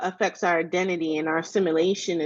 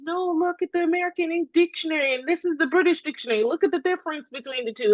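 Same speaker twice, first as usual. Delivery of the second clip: higher and fast, like a mother setting the record straight; insistent mother; 9 semitones higher, faster than usual. Retold or imitated. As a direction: rattle it off, pitched up, proving your point. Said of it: "my mother says".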